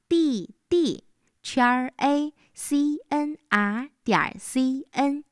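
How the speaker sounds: slow, letter by letter; slow spelling. Shown as a demonstration. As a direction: spell each letter out slowly. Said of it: "记好了吗？".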